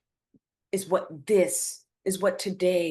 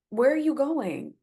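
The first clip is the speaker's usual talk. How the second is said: ordinary talk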